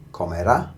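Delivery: comerá with emphasis on rá; comerá, rising onto a strong rá; final-syllable stress. Shown as a demonstration. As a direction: punch the last syllable of comerá. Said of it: "stressing that very last syllable".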